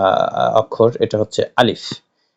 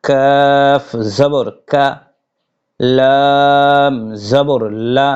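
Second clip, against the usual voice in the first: slow Arabic letter drill; 2 semitones higher, much slower than usual. Shown as a demonstration. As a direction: recite each letter slowly like a Quran teacher. Leads a recitation drill. (ধীরে টেনে টেনে আরবি হরফ পাঠ)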